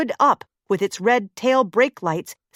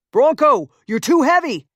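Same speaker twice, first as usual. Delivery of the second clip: raised urgent yell at the big man; 5 semitones higher, 5 dB louder than usual. Acted. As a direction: warn him off, loud and sharp. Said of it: "he yelled at the big man".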